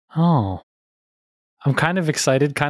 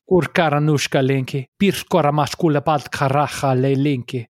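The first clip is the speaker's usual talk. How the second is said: mock Russian cat talk. Shown as a demonstration. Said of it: "It's like a pseudo-Russian".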